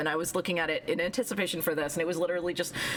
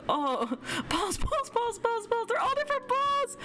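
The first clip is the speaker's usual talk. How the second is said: high squeals of delight; squealing excitement; 13 semitones higher than usual. Real, not acted. Streamer squealing at the character's balls, high and giddy. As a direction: squeal over every new ball, much higher.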